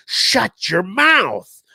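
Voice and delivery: slow, loud, high-pitched yell; angry shout. Retold or imitated. Dad silences son telling mom, imitated angry shout. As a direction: imitate a father roaring to cut someone off.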